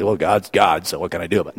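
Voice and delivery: a touch of resigned indifference, lightly voiced; mock fatalistic shrug. Pastor lightly mocking a fatalist, with a touch of resignation. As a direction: mimic a passive believer shrugging it off. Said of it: "passively or, or fatalistically".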